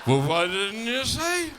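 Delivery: slow, loud mock-innocent reply; sarcastic husband. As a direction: act baffled, slowly.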